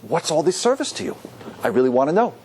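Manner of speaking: earnest question in an easy mid pitch; sincere wise son. Acted. Wise son asking about the Seder service, sincere. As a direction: ask as if truly wanting to know. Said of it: "The wise son is saying".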